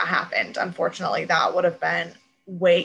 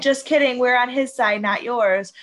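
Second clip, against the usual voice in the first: louder, higher sneering taunt; mock taunt; 4 semitones higher, 5 dB louder than usual. Chancellor's taunt to Francis, loud and smug. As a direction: tease them with a smug reveal. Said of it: "and was like".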